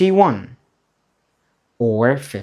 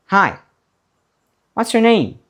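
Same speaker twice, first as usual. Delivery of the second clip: much higher, lively greeting; high bright prompt; 8 semitones higher, faster than usual. Shown as a demonstration. Teacher greeting learners during revision, pitched high. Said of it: "let's revise all the questions".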